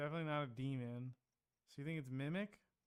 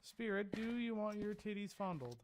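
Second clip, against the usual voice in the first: taunting the ghost; 6 semitones higher than usual. Acted.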